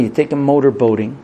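ordinary talk, nothing marked

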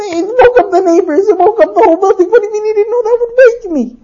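high, fast, incredulous husband; exasperated rant. Acted. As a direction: rattle off the complaint high-pitched. Husband ranting in acted quarrel.

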